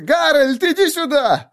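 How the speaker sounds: very high squealed call; shriek. A narrator's reading. Terrified captive calling his comrade to come, shrill shriek. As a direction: squeal for help at top pitch. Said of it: "взвизгнул человек".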